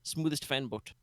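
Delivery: a light Jamaican-bayou lilt, easygoing; vaguely Jamaican bayou accent. Acted. Boat captain greets the party, lightly Jamaican bayou accent. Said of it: "vaguely Jamaican bayou accent".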